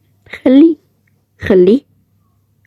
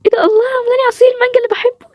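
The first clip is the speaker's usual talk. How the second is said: squealed delight; 13 semitones higher, faster than usual. Real, not acted. Thanking a friend for mango juice, squealed delight. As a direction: gush in a much higher voice.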